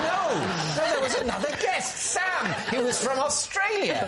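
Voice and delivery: high-pitched, insistent correction; mock-indignant reminder, pitched right up; exasperated protest. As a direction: protest it loftily high, barely believing they forgot. Imagined protest at being forgotten.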